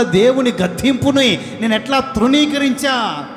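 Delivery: loud, high anguished cry of regret; regretful wailing. Acted. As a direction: wail it out, chest-beating remorse. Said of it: "ఒకరోజు గుండెలు బాదుకొని". (బిగ్గరగా పశ్చాత్తాప విలాపం)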